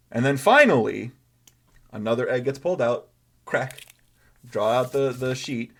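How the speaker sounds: ordinary delivery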